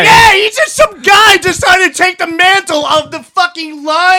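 worked-up outburst at the self-appointed line monitor; outraged shout. Real, not acted. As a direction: blurt it out in disbelief. Outraged retelling.

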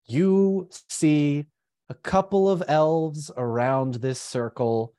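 suspenseful storytelling with long pauses; game master's narration. Acted. Game master revealing the spell's result to players, suspenseful narration. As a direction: draw out the key moments, then let it hang.

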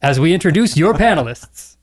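polished park announcer welcoming guests; Bill Rogers impression. Host opening a Disney special, announcer voice. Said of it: "doing that announcer voice".

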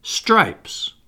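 Stripes said high, slow and distinct. A narrator's reading. Peter repeating the key word to slaves, slow and distinct. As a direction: land Stripes like a threat, slowly. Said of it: "he repeated slowly and distinctly".

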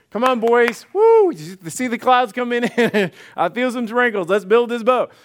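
loud, high, eager cheer to the crew; rally the boys with a whoop and a laugh; excited rallying call. Acted. Noah rallying his sons to build.

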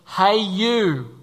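loud, hailing someone from above; booming mock voice from heaven; mock heavenly call. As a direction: call out to single someone out, playfully. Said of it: "a voice from heaven says".

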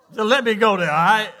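loud, laughing plea. Real, not acted. Pastor asking his laughing church to let him continue. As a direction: laugh while begging them to let you go on.

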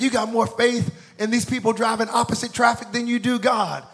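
high, insistent imitated rebuke; mock exasperated preacher; scolding preacher.

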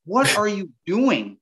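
slow, drawn-out incredulous question; mock disbelief. Buddy thinks the angler is crazy, drawn-out mock disbelief. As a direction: imitate a buddy who thinks you're crazy. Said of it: "He is thinking I'm crazy".

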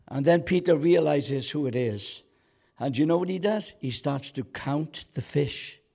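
ordinary delivery

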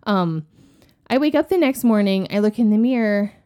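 ordinary talk, nothing marked

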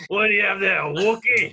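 loud, high mock-British guard; bad British accent. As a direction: ham up a poor British accent, loudly. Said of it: "British accents. And some of them are not good".

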